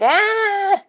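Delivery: high-pitched startled cry; acted shout. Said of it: "shouts, and he's like".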